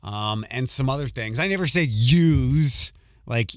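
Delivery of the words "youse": "use" drawn out as Philly youse. Shown as a demonstration. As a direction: say "use" loud and long, the Philly plural you. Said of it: "the Philly one".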